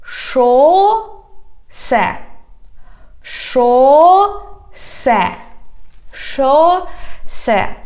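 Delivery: long "Шо", pause, then "се", repeated patiently; syllable by syllable. Shown as a demonstration. Teacher saying keep repeating after me, "Шосе" slowly by syllables. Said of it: "repeating after me".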